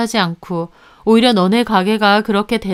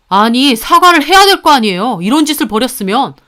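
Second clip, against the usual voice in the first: heated demand; 3 semitones higher, 7 dB louder than usual. Acted.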